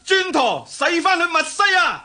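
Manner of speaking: shouted command. Acted. Jason shouts to Tiphys to turn back, commanding yell. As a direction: bark the order across the deck.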